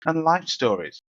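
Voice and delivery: emphatic podcast disclaimer and welcome; show announcer read. Acted. Host reads the warning and welcome to listeners, announcer style. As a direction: read it like a show intro, punching key words.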